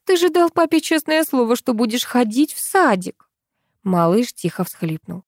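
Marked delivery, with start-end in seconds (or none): 0.0-3.3 higher-pitched, fussing appeal; lightly voiced mother clucking at her child. A narrator's reading. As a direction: coax with a touch of reproach. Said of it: "кудахтала мама".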